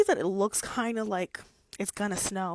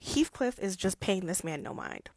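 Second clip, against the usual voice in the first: normal talking voice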